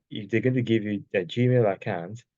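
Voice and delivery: ordinary talk, nothing marked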